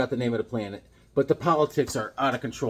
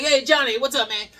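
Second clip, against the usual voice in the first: retold greeting; 11 semitones higher, 6 dB louder than usual. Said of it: "I was like".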